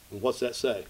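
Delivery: normal talking voice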